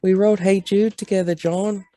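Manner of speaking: hammed-up high Liverpool accent. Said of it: "my bad Beatles accent".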